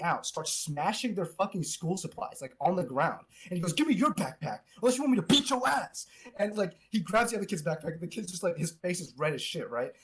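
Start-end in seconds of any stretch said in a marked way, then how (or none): 3.7-6.1 higher-pitched mock threat; retold menace, rising in pitch; imitated bully threat. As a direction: act out threatening a kid.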